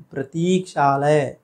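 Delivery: प्रतीक्षालय with stretched ती; long ee. Shown as a demonstration. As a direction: say "prateeek-shaalay" for प्रतीक्षालय. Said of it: "प्रतीक्षालय नहीं".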